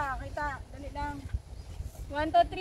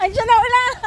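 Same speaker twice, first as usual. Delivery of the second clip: excited shout; 8 semitones higher, 6 dB louder, faster than usual. Real, not acted. Running from the rain.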